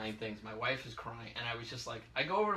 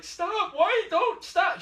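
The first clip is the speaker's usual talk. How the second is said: high, loud frantic cry; pleading in alarm to keep chasing; panicked plea; 10 dB louder, much slower than usual. Retold or imitated. Pregnant wife begging her husband, in a high frantic cry.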